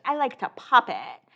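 lightly put-on voice mimicking her; Lauren impression. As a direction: imitate how she sometimes talks. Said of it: "You know how sometimes she talks like that?".

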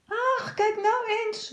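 high, slow pitying call; girl's call. A narrator's reading. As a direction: coo at the cat in the tree. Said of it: "roept Josephine". (hoog, meelevend meisjesgeroep)

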